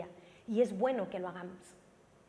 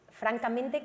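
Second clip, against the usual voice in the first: ordinary talk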